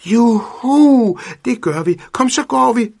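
high, loud cheer of joy; excited shout. A narrator's reading. Cat cheering to Pedersen before dashing off, loud and high. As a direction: shout with delight and run off. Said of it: "råbte Findus".

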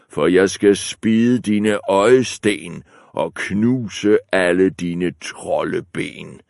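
slow, loud menacing goat; big goat threatening. Acted. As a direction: threaten him word by word.

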